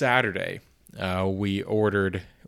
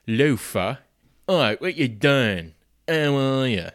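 higher, louder London-style British accent, hammed up; chirpy British greeting, overplayed for laughs; mock British accent; 5 semitones higher, 4 dB louder, slower than usual. Boyfriend teasing girlfriend about watching Luther, in a hammy British accent. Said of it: "by talking in a British accent".

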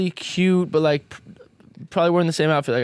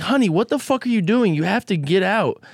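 higher, mock-angry honey what the; exasperated telling off; 5 semitones higher than usual. Roommate ordering the stranger girl out, raised exasperated voice. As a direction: tell a stranger to get out, half yelling.

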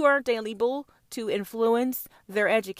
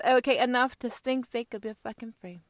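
usual speaking voice

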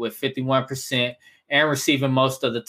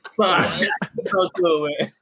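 higher pitch, laughing through words; 6 semitones higher than usual. Real, not acted. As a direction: laugh while you say it. Laughing about bad accents.

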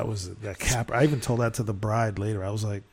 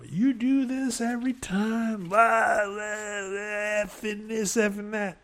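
high mock quarrel, stretched out; imitated bickering; 8 semitones higher, slower than usual. Sister fighting the bride's mother, mock bickering. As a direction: mimic a ranting relative in a raised pitch. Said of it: "is fighting with the mother of the bride".

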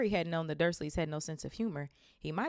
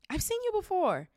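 put-on eager boy; 6 dB louder than usual. Harry recognizes Diggle.

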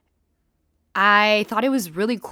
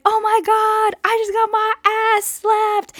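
high, loud giddy squeal; squealing excitement; 14 semitones higher, 4 dB louder than usual. Retold or imitated. Telling her friend she just got her ass slapped, squealing.

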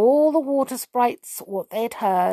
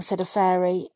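plain reading voice

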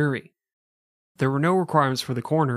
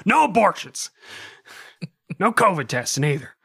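higher, louder angry ranting; mock Greg Abbott ranting; 7 semitones higher, 5 dB louder than usual. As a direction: rant and curse from the stairs. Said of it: "He's like".

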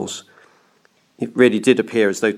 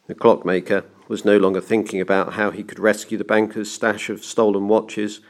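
plain reading voice

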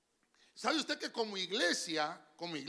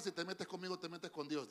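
softer, lower aside to the neighbour; say to your neighbour; 4 semitones lower, 9 dB quieter than usual. Shown as a demonstration. Preacher telling the church to tell their neighbour, softly. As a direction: murmur it to the person beside you. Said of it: "Dígale al que tiene a la par".

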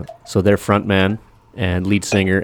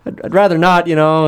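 much higher squeaky refusal; hammed-up kid voice, higher and louder; 11 semitones higher, 4 dB louder than usual. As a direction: pitch right up like a scared little boy. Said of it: "Kid's like".

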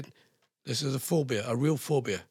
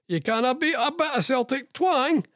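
higher-pitched, playful Celtic twang; put-on Celtic twang; 8 semitones higher than usual. Radio host joking about the accent, mock Celtic twang. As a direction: ham up the Celtic twang a little. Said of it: "the old Celtic twang".

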